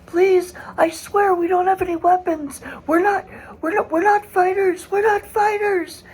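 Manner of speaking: pleading father. Retold or imitated. Father begging soldiers not to shoot, high panicked pleading. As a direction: beg for your family's lives at gunpoint. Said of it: "the father, is going".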